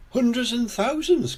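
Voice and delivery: put-on high-pitched exclamation from a child; crying out. A narrator's reading. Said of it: "cries Peppa".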